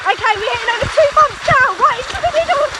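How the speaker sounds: high, excited ski-race commentator; frantic sports commentary. Acted. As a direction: call it like a live race. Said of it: "I'm gonna do that for you".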